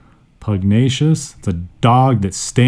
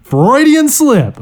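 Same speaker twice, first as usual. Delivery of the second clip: much higher, slow squeal; amused squeal; 4 dB louder, much slower than usual. Real, not acted. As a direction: squeal at the embarrassing slip. Laughing at own slip.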